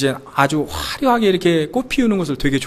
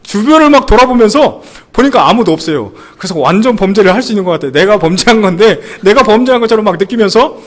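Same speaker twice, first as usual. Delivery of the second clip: excited; 4 semitones higher, 6 dB louder than usual. Real, not acted. Comic guilty excitement.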